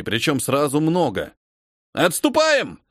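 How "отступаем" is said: high urgent retreat call; shouting orders over battle. A narrator's reading. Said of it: "отдал приказ сержант". (громкий приказ отступать)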